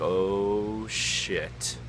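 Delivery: dawning dread. Retold or imitated. Waking to yelling, dread.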